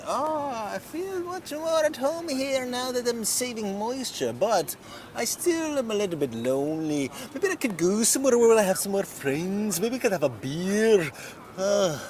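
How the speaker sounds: high-pitched, loud Mud Dog voice; high character voice. Acted. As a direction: squeak it out as the goofy character.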